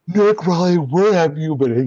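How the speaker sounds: excited nerd calling out his own name; Nick Riley voice. Acted. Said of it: "It's the voice".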